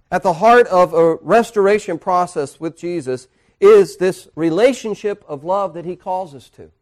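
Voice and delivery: fervent preaching voice. Acted. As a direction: drive the point home from the pulpit. Preacher rises to climax.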